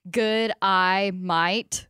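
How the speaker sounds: "Good, I might" spaced out slowly, word by word. Shown as a demonstration. Reading a meme's words out to the hosts, slow and deliberate. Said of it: "Say this out loud".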